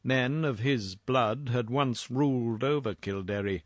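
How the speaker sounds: ordinary delivery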